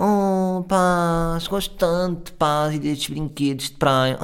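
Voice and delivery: put-on high, childish baby voice, slow; mock a grown woman gushing like a little girl; imitating a cutesy woman. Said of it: "E disse assim". (imitando uma mulher infantil, voz aguda e lenta)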